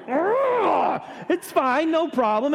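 high exasperated ugh, then a strained it's fine; to the clerk who gave the wrong fax number, strained politeness; forced cheer. Retold or imitated. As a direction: swallow the frustration and smile through it.

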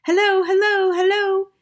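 high, loud parrot call; high-pitched voice carrying from afar; loud, clear parrot. A narrator's reading. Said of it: "a voice came out loud and clear".

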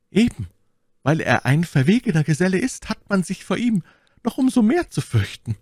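high, theatrical Milady voice; high, formal courtly request; scheming lady petitioner. A narrator's reading.

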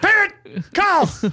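very high mock announcer squeal; high-pitched game show shout; game title callout. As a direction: call it out in a silly high voice. Repeats the silly title callout.

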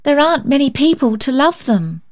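put-on high earnest child; pleading child. A narrator's reading. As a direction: urge her gently. Polly pleading.

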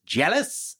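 a comedian's voice imitated back at a young opener; Jon Lovitz impression. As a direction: toss off one smug word like the famous comic. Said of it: "Why are you talking like me to me?".

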